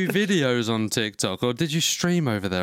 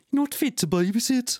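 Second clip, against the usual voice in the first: put-on Teesside accent; 11 semitones higher than usual. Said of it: "I can't do the accent".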